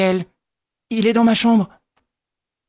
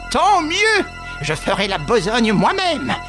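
half-mad cry; 9 semitones higher, slower than usual. A narrator's reading. Widow to nephew, crying out half-mad. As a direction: cry it out like a madwoman. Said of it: "cria la veuve ... à moitié folle".

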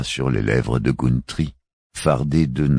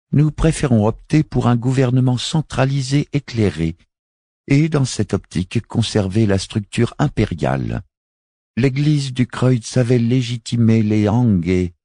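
higher, measured woman's voice; a solemn alien envoy, voiced higher; Miaït's voice; 11 semitones higher, 4 dB louder than usual. Miaït speaks again. (voix aiguë et posée)

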